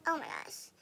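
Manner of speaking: ordinary talk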